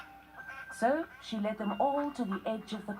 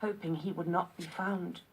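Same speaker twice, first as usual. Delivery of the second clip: plain reading voice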